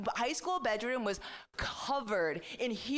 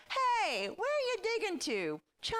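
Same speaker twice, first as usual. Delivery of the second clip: high called-out question; childhood call-out; 13 semitones higher than usual. Retold or imitated. Calling to friends from a dug hole. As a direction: call across the yard like a kid. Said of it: "yell out to them".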